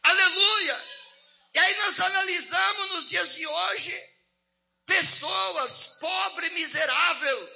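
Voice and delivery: preaching at full force. Acted. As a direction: preach it out loud and high to the church. (pregação exaltada, em voz alta e forte)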